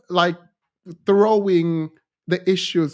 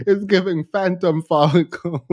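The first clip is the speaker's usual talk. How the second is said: ordinary talk, nothing marked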